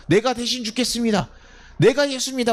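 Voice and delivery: high-pitched, acted volunteering; mock brave declaration. Imagined believer offers to die for Jesus, brave declaration. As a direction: proclaim it as a would-be martyr.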